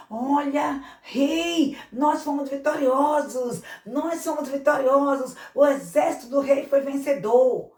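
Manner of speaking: loud, high, jubilant cry to the king; triumphant report. Acted. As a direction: announce the victory with glee. Army calls triumphantly to the king.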